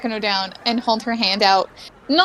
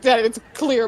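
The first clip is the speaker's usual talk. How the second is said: normal talking voice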